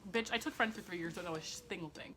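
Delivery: ordinary talk, nothing marked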